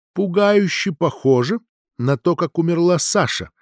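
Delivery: usual speaking voice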